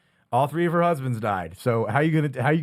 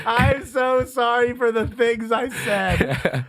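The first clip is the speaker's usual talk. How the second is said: slow, high mock-sorry voice; slow sing-song mock apology; 7 semitones higher, much slower than usual. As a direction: drag it out, teasing the apology. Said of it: "mimicking friend's apology".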